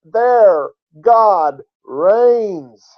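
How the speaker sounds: high, building to very slow drawn-out words; emphatic preaching. Real, not acted. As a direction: proclaim it, stretching "their God reigns". Climax of the sermon's point.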